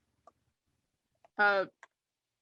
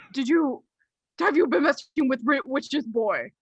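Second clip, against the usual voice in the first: raised, louder, frantic doctor questioning; urgent interrogation; 6 semitones higher, 7 dB louder than usual. Acted. Doctor asking boy about messing with witches, overwrought demand. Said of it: "in that tone of voice".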